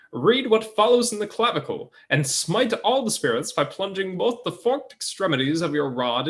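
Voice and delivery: put-on old-time radio announcer, high and bright; Mid-Atlantic radio voice. Shown as a demonstration. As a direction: read it like a 1940s radio broadcaster. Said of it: "a weird Mid-Atlantic radio voice".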